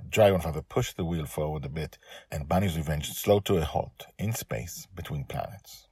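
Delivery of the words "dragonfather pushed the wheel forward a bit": usual speaking voice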